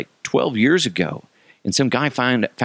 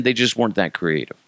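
ordinary delivery

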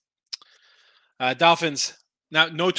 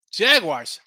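ordinary talk, nothing marked

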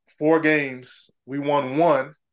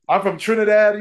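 higher and louder insistent repeat; emphatic repeat; 6 semitones higher, 6 dB louder than usual. Real, not acted. To the host, insisting on his outsider point. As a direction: punch it out insistently.

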